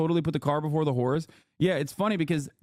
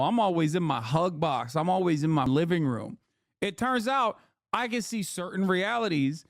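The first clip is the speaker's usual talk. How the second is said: ordinary talk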